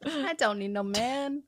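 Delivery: high sassy quote, laughing; sassy attitude. Retold or imitated. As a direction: echo it with sass.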